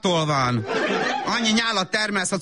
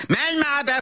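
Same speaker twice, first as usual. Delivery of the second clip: high, exasperated command; impatient order; 9 semitones higher, slower than usual. Acted. Mother at the nursery door, suddenly impatient. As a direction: lose patience and send the child in.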